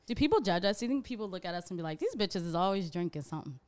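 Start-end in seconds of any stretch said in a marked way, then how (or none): none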